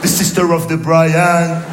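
put-on very slow, heavy French-school English accent. Comedian mocking the school lesson, slow French-accented English. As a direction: drone it like a language tape. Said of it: "Avec l'accent".

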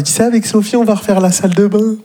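high, chirpy smug voice; smug brother-in-law. Retold or imitated. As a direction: gush about the bathroom plans. (beau-frère content de lui, voix aiguë)

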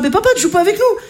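enfant aigu qui s'étonne; voix d'enfant. Retold or imitated. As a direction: une petite voix d'enfant qui réclame. Les enfants demandent à papa. (les enfants demandent à papa, voix d'enfant aiguë)

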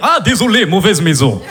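low laughing mock Russian; mock Russian accent. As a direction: apologize as the Russian mobster. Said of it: "Je fais bien l'accent comme ça".

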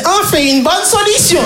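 high, relieved granny voice; delighted old lady. Retold or imitated. Yvonne hears the joke and is delighted, high granny voice. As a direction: chirp it with relief.

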